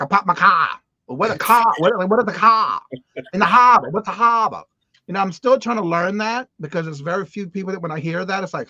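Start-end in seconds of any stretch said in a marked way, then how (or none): 0.0-4.6 light imitation of a Boston accent, quoting locals. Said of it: "trying to understand what they're saying".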